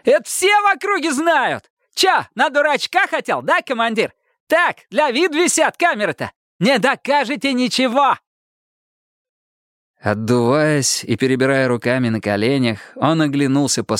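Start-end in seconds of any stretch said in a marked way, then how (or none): 0.0-8.2 very high-pitched gloating taunts; triumphant crowing. A narrator's reading. Detainee mocking officers over dummy cameras, triumphant high crowing. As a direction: taunt them in a thin, jubilant voice. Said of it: "торжествуя крикнул Романов".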